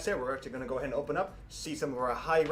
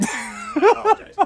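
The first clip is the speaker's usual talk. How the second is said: hammy apology; 15 dB louder, slower than usual. Pretend. Mock apology after being roasted.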